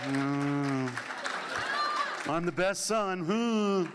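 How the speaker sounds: comic hummed gloating, lilting upward; drawn-out hums round a silly boast; mock self-congratulation. Storyteller after standing up to dad, mock smug. Mimics self-satisfied, torn humming.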